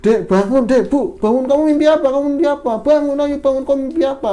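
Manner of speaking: urgent waking call. Retold or imitated. Husband waking his wife from a nightmare, loud and urgent. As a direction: shake her awake with your voice.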